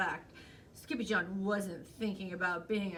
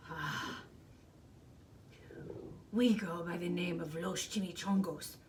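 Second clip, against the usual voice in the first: low-key growled introduction; growling chihuahua leader; 3 semitones lower than usual. A narrator's reading. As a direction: growl it like the gang's boss. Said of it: "growled Don Diego".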